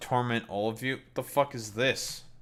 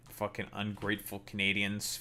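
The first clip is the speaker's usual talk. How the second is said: ordinary talk, nothing marked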